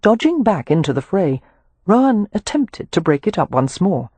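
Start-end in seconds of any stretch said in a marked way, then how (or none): none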